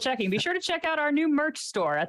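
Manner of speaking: fast, upbeat fake commercial; joking announcer pitch; mock ad read. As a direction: rattle it off like a radio ad. Mock ad read for merch store.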